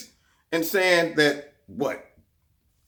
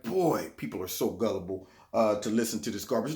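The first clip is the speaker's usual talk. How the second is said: muttered thought; 7 semitones lower, 7 dB quieter than usual. Retold or imitated. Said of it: "I was sitting there like".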